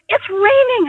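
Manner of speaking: high mock-whiny protest; complaining partner. Partner protesting the rain, mock whiny and high. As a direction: protest like a put-upon partner. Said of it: "And he goes".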